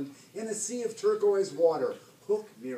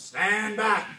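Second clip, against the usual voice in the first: moderately raised pirate bellow; bellowed command; 4 semitones higher, 4 dB louder than usual. A narrator's reading. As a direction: bellow to clear the crew away. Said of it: "bellowed Hook".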